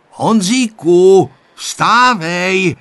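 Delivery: loud, high wake-up call. A narrator's reading. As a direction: rouse a sleeper, drawing the name out loudly. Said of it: "řekl Viktor nahlas".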